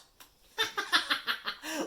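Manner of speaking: high, loud mocking cackle; poltergeist cackle. Peeves cackles at the first-years, high and loud. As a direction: shriek with glee.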